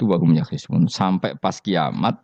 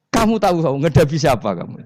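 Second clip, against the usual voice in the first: mock shout; 8 semitones higher, 3 dB louder than usual. Umar confronts Munkar Nakir in grave.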